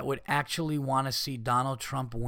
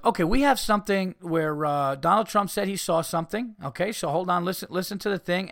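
mock TV host; 6 semitones higher than usual. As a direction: ham up a smug morning-show host. Mocking TV hosts on Today Show.

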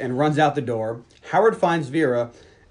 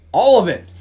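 higher and louder, eager for more; eager plea; 6 semitones higher, 10 dB louder than usual. Retold or imitated. Farm boy begging Vera for more compliments, eager and loud. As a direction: beg to hear it all again.

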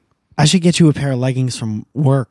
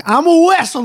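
very high-pitched excited impression; mock wrestler kid. Teasing co-host about wrapping up in towels, high mock voice. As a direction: squeal it like a child playing dress-up. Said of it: "be like".

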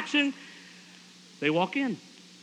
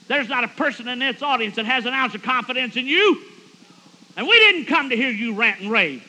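high, loud scolding yell; brutal, mad; 7 semitones higher, 8 dB louder than usual. Retold or imitated. Fisherman's wife shouts down her ranting husband, brutal and mad. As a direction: yell him down in an acted fury. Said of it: "when she gets mad, she's brutal".

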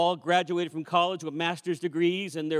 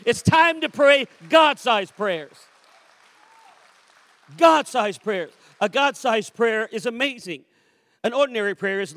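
loud, higher preaching that builds to a call for praise; rousing preaching; 5 semitones higher, 7 dB louder than usual. Real, not acted. Preacher rallying the church to pray big, rousing preaching. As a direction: preach it up to a forceful peak.

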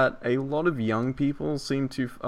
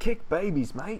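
higher-pitched, played-up Aussie accent; Australian accent; 7 semitones higher than usual. Demonstrating horrible talk forgiven for its charm, Australian accent. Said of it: "you said it in an Australian accent".

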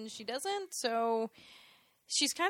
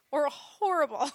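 louder, tipping into a laugh; breaking into laughter; 6 semitones higher, 10 dB louder than usual. Real, not acted. Co-host judging a song lyric, starting to laugh. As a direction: crack up on the last word.

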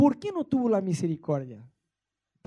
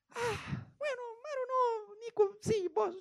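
much higher, softer, fake sobbing; hammed-up teary whimper, forgiving through tears; mock crying; 9 dB quieter, slower than usual. Mock tearful grudging forgiveness. (llanto fingido, agudo y suave)

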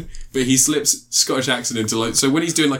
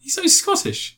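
very high, drawn-out protest; high-pitched, incredulous rebuttal; insistent contradiction; slower than usual. Real, not acted. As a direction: insist in a high squeal, stretching it slowly. Playful argument over actor's nationality.

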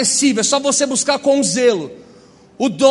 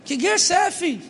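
high, hammed-up old church lady mishearing; mock old lady asking what war; 8 semitones higher than usual. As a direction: squeak it like a confused church sister.

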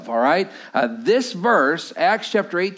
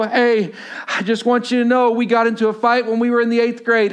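put-on nervous teen greeting; 5 semitones higher than usual. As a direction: nervously offer a hand to the old rival. Nervously approaching old rival.

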